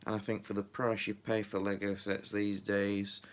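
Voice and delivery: usual speaking voice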